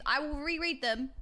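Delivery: ordinary delivery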